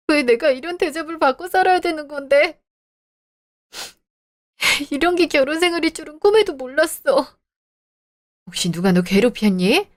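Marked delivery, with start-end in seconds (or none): 0.1-7.4 choked-up complaint to her mother; tearful. Acted. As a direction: hold back tears while telling mom. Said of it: "울었니?".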